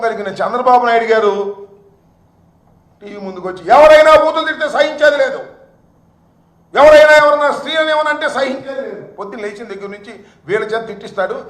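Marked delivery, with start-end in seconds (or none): 3.8-5.4 high, loud mock proclamation; mock pious declaiming. Party leader before TV cameras forbidding abuse, loud mock declaiming. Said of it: "Bhagavad Gita శ్లోకాలు చదివినట్టుగా చదివేటటువంటి". 6.7-9.0 high, loud mock proclamation; mock pious declaiming. Party leader before TV cameras forbidding abuse, loud mock declaiming. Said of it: "Bhagavad Gita శ్లోకాలు చదివినట్టుగా చదివేటటువంటి".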